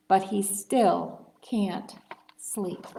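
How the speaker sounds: plain reading voice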